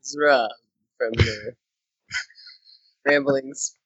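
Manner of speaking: very deep, slow mock bass voice; Rob impersonation. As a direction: sink the voice way down low. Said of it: "Let's all do a Rob impersonation".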